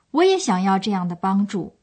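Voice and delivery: ordinary delivery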